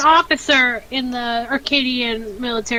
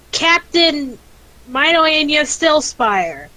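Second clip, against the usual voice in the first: proud; 4 semitones higher, 5 dB louder, much slower than usual. Acted. Announcing rank in character.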